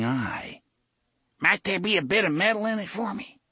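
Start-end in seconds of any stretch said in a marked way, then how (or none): 1.4-3.4 put-on high, quick, wheedling old man; greedy old man. A narrator's reading. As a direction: angle for a coin eagerly. Old man angles for money.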